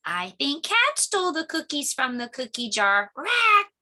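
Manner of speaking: high squawking bird. Acted. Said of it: "said the bird".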